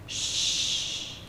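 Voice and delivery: soft hushing whisper; mother hushing Larry from a bad dream in a whisper. A narrator's reading. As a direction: hush him gently awake. Mother waking child from dream.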